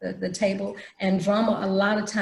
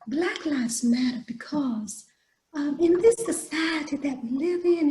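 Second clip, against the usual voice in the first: prissy lady; 6 semitones higher than usual. Retold or imitated. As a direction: play a dainty, prissy lady. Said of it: "a very prissy lady".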